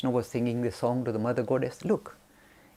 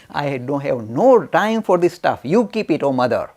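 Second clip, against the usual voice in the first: louder, slow brush-off; dismissive refusal; 8 dB louder than usual. Retold or imitated. Ramakrishna dismissing the Mother Goddess, slow and loud. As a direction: wave the gift away, slow and loud. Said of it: "He said".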